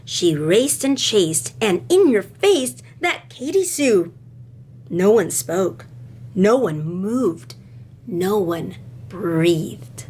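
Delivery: put-on expressive read-aloud to children; lively classroom storytime voice; storybook reading. A narrator's reading. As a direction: tell it like a picture book to kids. Reads a picture book aloud to class.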